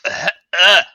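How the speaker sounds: high, loud sputtering outrage; sputter, then demand why; flustered disbelief. Acted. Director baffled by Aether's flavors, high sputtering outrage. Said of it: "and he's like".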